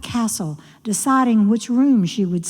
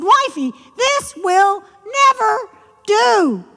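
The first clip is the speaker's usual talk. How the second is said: high, emphatic frog voice; high-pitched, indignant frog; exasperated frog husband; 12 semitones higher, 3 dB louder than usual. Acted. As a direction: put your frog foot down, word by word. Frog's final verdict to his wife.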